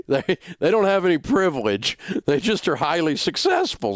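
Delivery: high voice just after a big laugh; amused, high-pitched. Real, not acted. Host defending successful colleagues after his joke, amused and high. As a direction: squeal it out, still tickled.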